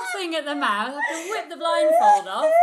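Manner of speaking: laughing through a loud retelling. Real, not acted. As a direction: shout it out while cracking up. Laughing while retelling a prank.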